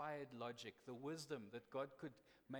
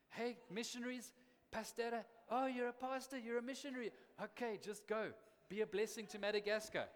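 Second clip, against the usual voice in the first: higher and louder, a hammed-up policeman brushing off missionaries; mock corrupt cop; 7 semitones higher, 5 dB louder than usual. Preacher imitating a Madagascar policeman waving off missionaries, mock officer voice. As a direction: play the bribe-hungry officer waving them through.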